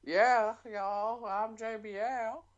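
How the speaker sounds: high, slow Southern drawl; JBL impression. Shown as a demonstration. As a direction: pitch it up for a mock Southern accent. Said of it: "Why do you go higher?".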